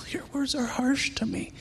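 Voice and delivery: breathy hushed scripture line; whispered verse. Retold or imitated. Preacher repeating God's words to the congregation, whispered. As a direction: whisper it back like a secret.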